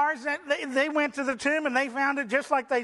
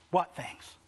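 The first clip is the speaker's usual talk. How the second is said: soft, lower, gentle question; loving; 6 semitones lower, 4 dB quieter than usual. Acted. Jesus gently asking his sad disciples, tender and soft. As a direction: draw them out tenderly. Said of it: "Jesus lovingly says".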